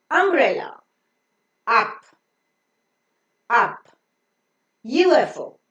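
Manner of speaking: ordinary talk, nothing marked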